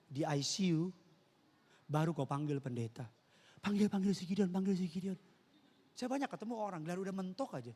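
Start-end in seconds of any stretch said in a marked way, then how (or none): 3.6-5.2 urgent plea. Retold or imitated. Patient's urgent call for pastor.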